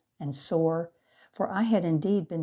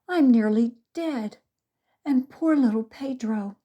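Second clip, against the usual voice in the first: wailing; 7 semitones higher, 6 dB louder than usual. A narrator's reading. Maria wails after being bitten.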